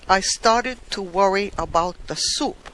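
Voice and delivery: usual speaking voice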